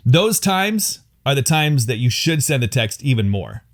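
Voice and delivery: ordinary talk, nothing marked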